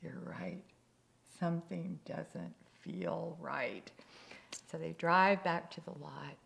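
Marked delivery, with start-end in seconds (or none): 0.0-3.9 slow, deflated admission; acted letdown, drawn out; reluctant concession. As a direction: give in slowly after all that excitement. Husband finally concedes to wife.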